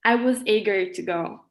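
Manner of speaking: ordinary talk, nothing marked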